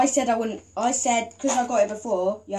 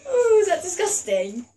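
laughing; 9 semitones higher than usual. Real, not acted. Laughing reaction.

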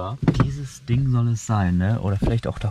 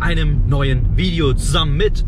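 energetic vlog intro; 3 semitones higher, 7 dB louder than usual. Acted.

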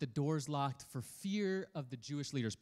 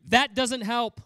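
exasperated retort; 7 semitones higher, 12 dB louder, slower than usual. Acted. Disciple retort.

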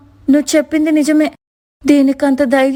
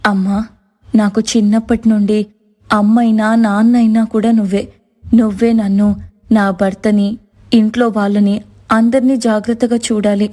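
devout prayer; 4 semitones lower than usual. Acted. Priya praying before Lakshmi, humble low prayer. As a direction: pray earnestly, voice dropped.

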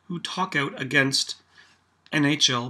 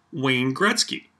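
ordinary delivery

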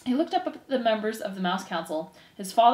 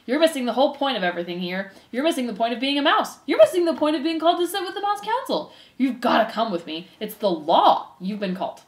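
insistent scolding that climbs higher point by point; exasperated brother mouse; 5 semitones higher, 4 dB louder than usual. Acted. Furlow orders his brother to the council, exasperated. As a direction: lecture him with mounting exasperation.

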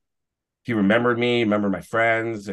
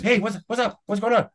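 much higher, excitable hello; imitated eager greeting; 12 semitones higher than usual. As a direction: act out an overexcited star saying hi. Acting the celebrity's eager greeting.

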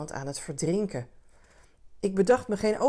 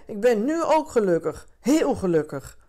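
loud, raised, insistent shout; 4 semitones higher, 6 dB louder than usual. A narrator's reading. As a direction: shout it as if to prove it. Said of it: "schreeuwde hij". (luid schreeuwend)

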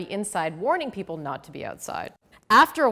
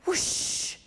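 whispered whoosh of wind; 5 dB quieter than usual. A narrator's reading. Wind gust sound.

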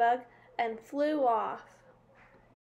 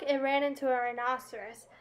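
ordinary delivery

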